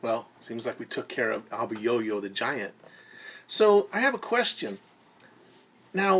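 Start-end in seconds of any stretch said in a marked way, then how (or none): none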